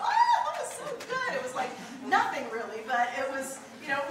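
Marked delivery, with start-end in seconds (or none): 0.0-1.3 mock family praise. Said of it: "everybody would go".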